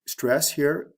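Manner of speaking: usual speaking voice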